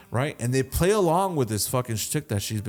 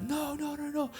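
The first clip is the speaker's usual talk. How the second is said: high, soft, panicky denial; freaking out; 9 dB quieter than usual. Retold or imitated. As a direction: protest in a small, high mock panic. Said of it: "He's like, freaking the fuck".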